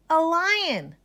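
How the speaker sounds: put-on high, loud boy's reply; high child answer. Ned answers the teacher about his lion. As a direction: answer proudly like a small boy. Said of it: "said Ned".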